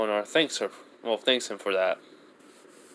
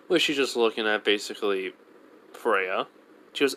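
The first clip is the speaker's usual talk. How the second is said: ordinary delivery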